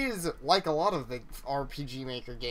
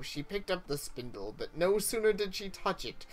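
ordinary talk, nothing marked

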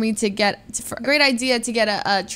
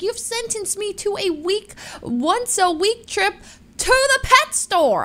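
high, slow, rising mock outrage; 10 semitones higher, slower than usual. To her boyfriend about weekly cricket trips, high slow mock outrage. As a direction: complain in mock horror. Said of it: "I was like".

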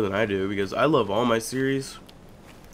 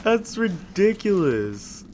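incredulous; 10 semitones higher, much slower than usual. Real, not acted. Exasperated after pet's death.

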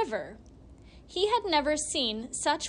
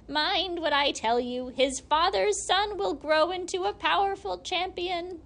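repeated warning, higher and louder, rising to the prophecy; hag's warning; 6 semitones higher, 3 dB louder than usual. A narrator's reading. Hag pressing her prophecy on the others, louder. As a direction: insist on it this time, a lightly voiced hag. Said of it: "said the other hag".

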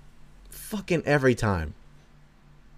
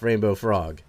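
ordinary talk, nothing marked

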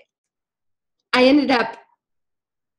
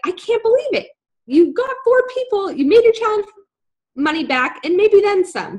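high-pitched, gushing imitation of a friend; excited congratulations; 10 semitones higher, 3 dB louder than usual. Friend celebrating her four sign-ups, thrilled. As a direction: cheer her on, voice way up.

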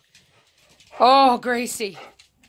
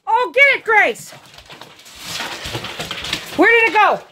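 frantic; 10 semitones higher, faster than usual. Real, not acted. Chasing intruder in panic.

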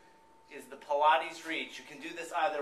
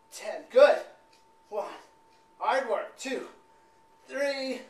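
higher, louder and strained by the end; straining during Pilates reach; 7 semitones higher, 9 dB louder, much slower than usual. Real, not acted. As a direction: push out the counts mid-exercise. Coach doing the Pilates reach herself.

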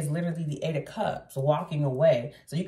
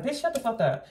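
high mock snap, pitched way up; snapping shut-up; 8 semitones higher than usual. Leo snapping at the fake congratulator, high snap. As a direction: bite back at the fake well-wisher. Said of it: "You're gonna be like".

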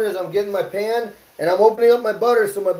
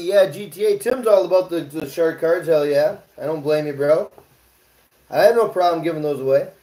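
ordinary talk, nothing marked

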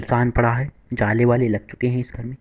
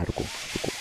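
breathy whisper, hold back; hushed stop; 11 dB quieter than usual. Real, not acted. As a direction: whisper so enemies can't hear. Sneaking up on enemies.

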